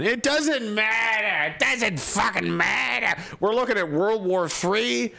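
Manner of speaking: high, slow, drawn-out despair; exasperated. Real, not acted. Streamer to chat about the news, in high slow exasperation. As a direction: stretch it out like you've given up on the world.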